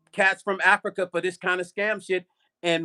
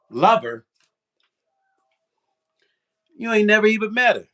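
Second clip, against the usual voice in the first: louder, scoffing disbelief at the word lover; incredulous retort; 4 semitones higher, 5 dB louder than usual. Retold or imitated. Narrator scoffing at the scammer, louder incredulous retort. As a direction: spit it back at him, louder and disbelieving. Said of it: "I said to myself".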